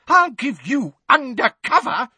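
put-on high, loud outraged old woman; crying out. A narrator's reading. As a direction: shout it back at him. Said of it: "cried Missus Figg".

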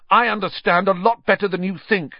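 loud, high, angry retort; shaking with emotion. A narrator's reading. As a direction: fire back with a shaking voice. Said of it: "his voice shook more than ever".

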